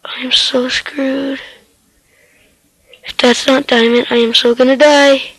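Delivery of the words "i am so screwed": slow frightened whisper, no voice. Real, not acted. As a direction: breathe it out in dread. Whispered in danger.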